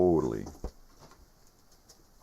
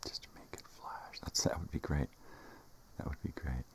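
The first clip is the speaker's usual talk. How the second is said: whispering; 10 dB quieter than usual. Real, not acted. Whispering to self.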